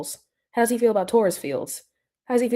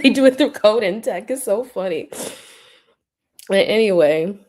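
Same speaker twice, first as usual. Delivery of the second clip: ordinary talk, nothing marked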